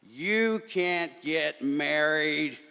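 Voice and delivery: lightly voiced bossy stink bug; scary stink bug. A narrator's reading. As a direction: forbid it slowly. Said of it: "said a scary voice".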